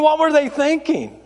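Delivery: high-pitched disbelief; incredulous comic complaint. Real, not acted. Mock disbelief, said twice.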